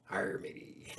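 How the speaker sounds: growly pirate arr; lightly voiced pirate. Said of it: "I like my pirate voice".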